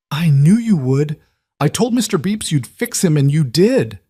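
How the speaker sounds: put-on bright eager six-year-old; child voice. A narrator's reading. Narrator voices the young daughter.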